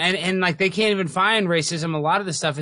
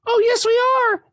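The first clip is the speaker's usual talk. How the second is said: squeal; 3 dB louder than usual. Pretend. Mock mayor voice squeals.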